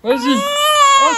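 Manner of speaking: squeaky high. Pretend. Parent egging baby on, squeaky high.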